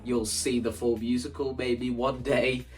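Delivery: ordinary talk, nothing marked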